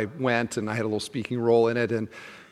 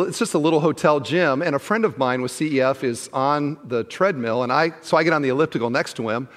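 ordinary talk, nothing marked

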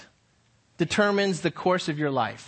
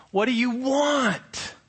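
high, slow and exasperated on "want"; in frustration; 6 semitones higher, much slower than usual. Shown as a demonstration. Pastor showing frustration to the youth group, high and drawn out. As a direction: ask it like you're fed up. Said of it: "in frustration, I could say".

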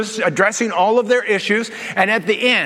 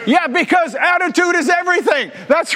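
emphatic shout; 9 semitones higher than usual. Real, not acted. Pastor driving home his catchphrase, shouting. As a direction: belt it out to the whole church.

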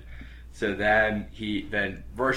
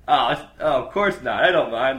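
nervous; 5 semitones higher, 9 dB louder than usual. Retold or imitated. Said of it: "he's, like, fucking terrified".

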